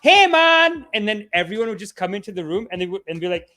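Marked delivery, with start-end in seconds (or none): 0.0-0.8 put-on high, loud Jamaican accent greeting; Jamaican sketch greeting. In Living Color sketch title, loud Jamaican greeting. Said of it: "the whole sketch was called".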